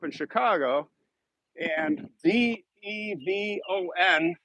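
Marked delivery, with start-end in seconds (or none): 2.2-4.3 D E V O N letter by letter; slow, even spelling of D E V O N; spelled out. Shown as a demonstration. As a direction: spell D E V O N slowly, one letter at a time. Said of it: "how to pronounce words here".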